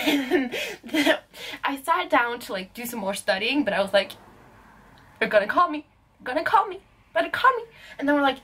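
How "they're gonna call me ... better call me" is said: building anticipation. Retold or imitated. Vlogger waiting by the phone for a call, building anticipation. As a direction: repeat it with pitch climbing each time.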